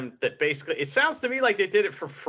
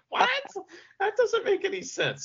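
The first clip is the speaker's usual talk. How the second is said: incredulous high squeak. Real, not acted. Host reacting to a nonsensical interview claim, incredulous high squeak. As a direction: pitch shoots way up in disbelief.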